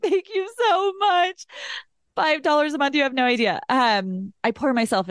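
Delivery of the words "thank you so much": high, slow, drawn-out gratitude; happy, touched thanks. Retold or imitated. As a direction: gush it in a small high voice, acted out. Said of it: "It made me so happy".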